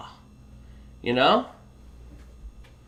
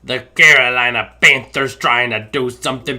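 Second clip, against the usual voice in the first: pirate play-by-play; hammed-up pirate commentator; pirate accent; 4 semitones lower, 4 dB louder than usual. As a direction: call the game like a stage pirate. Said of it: "stop with the pirate accent".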